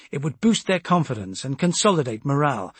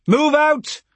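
high, loud command; shouted order; 13 semitones higher, 8 dB louder than usual. A narrator's reading. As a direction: send the whole column off. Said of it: "I ordered".

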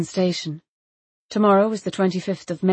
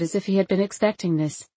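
plain reading voice

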